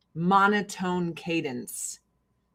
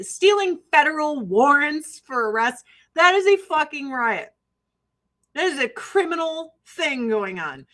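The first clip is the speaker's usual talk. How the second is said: normal talking voice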